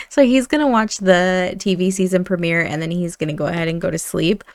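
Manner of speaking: usual speaking voice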